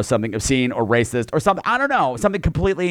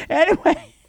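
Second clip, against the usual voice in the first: camp anyway. Acted.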